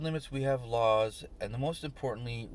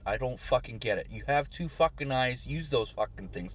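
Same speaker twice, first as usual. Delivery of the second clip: normal talking voice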